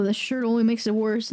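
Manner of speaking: normal talking voice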